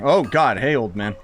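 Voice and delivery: ordinary delivery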